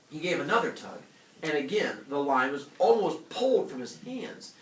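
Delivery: ordinary talk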